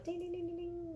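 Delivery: high, rapid run of ringing dings; imitated dinner triangle. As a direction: sing out a soft, high triangle jingle. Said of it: "those little triangle".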